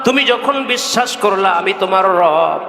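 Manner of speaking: high, ringing sermon call, long-held endings; impassioned preaching. Real, not acted. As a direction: call out to the congregation in a high chant. (উঁচু সুরে ওয়াজ)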